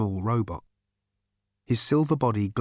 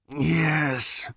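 abashed grumble; 6 semitones higher than usual. Acted. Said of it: "grumbled the machine, somewhat abashed".

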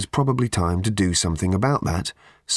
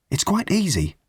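higher-pitched, encouraging Arthur; lightly voiced urging, pitched up to coax her; gentle urging; 9 semitones higher than usual. A narrator's reading. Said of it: "urged Arthur".

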